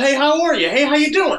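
high, hearty mock hello; jokey big greeting. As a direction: holler a casual hello to everyone. Voices event as big greeting.

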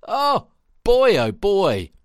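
put-on loud, high "oh boy" of disbelief; mock dismay. Listener reacting to an audiobook blunder, mock dismay. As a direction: exclaim in exasperation, then come down a little.

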